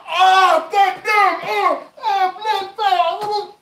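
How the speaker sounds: high, very loud pained yelps; acted pain cries. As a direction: cry out like you just got punched in the nose. Man hit in the nose.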